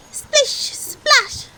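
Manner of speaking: very high, loud gleeful splish splash; playful splash. A narrator's reading. Children splashing in puddles, high and gleeful.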